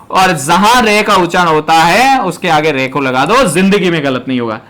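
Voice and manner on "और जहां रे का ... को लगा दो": ordinary delivery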